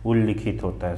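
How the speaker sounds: उल्लिखित as ul-LI-khit, short i; correct vowel. Shown as a demonstration. Teacher answers the spelling question, उल्लिखित with li. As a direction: use a short i after ll in उल्लिखित. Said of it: "सही वर्तनी क्या होता है".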